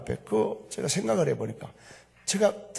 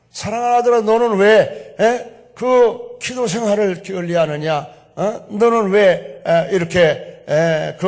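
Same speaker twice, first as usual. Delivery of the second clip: loud, higher scolding with prodding interjections; retold rebuke; 5 semitones higher, 9 dB louder, slower than usual. Preacher voices rebuke of his prayer life, loud scolding. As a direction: reprove a beloved son, forceful and raised. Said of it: "나를 책망하는 거예요".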